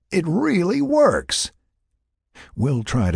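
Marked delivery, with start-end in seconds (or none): 0.1-1.5 high, slow marvelling; amazed praise. A narrator's reading. Jack praising Will's homemade flute, amazed praise. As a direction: praise it in drawn-out, high-pitched wonder. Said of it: "Jack praised".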